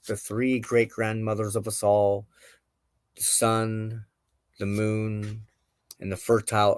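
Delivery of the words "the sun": usual speaking voice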